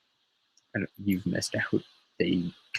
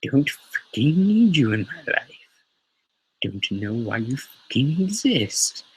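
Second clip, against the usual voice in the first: put-on higher, louder brush-off of a clingy girlfriend; dismissive Joker; 5 semitones higher, 4 dB louder than usual. Joker telling Harley Quinn to leave him alone, higher and louder. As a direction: snap her away as an annoyed Joker. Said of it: "Joker's just like".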